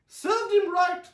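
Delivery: very high, loud, slow gloat; Aunt Summer dismisses the grandfather, slow loud gloat. A narrator's reading. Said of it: "said Aunt Summer".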